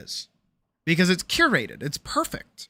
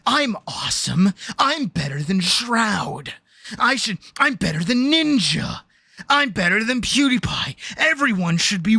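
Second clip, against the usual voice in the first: slow bragging mock voice building to a yell; entitled streamer; 4 semitones higher than usual. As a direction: brag like a bitter streamer, rising to a shout.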